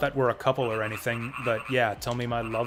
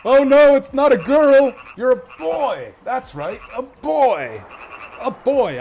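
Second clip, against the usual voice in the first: much higher, louder anguished cry; dramatic shout; 11 semitones higher, 11 dB louder, slower than usual. Acted. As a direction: wail in horror at the mistake. Voiced NPC discovering mistake.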